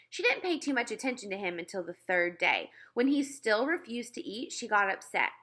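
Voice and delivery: plain reading voice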